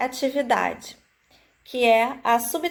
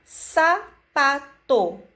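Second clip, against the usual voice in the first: sapato said slowly, syllables apart; slow sounding out; 2 semitones higher, much slower than usual. Shown as a demonstration. Teacher to children, sounding out a word slowly. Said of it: "enfatizando cada sílaba".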